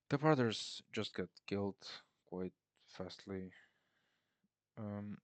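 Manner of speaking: ordinary talk, nothing marked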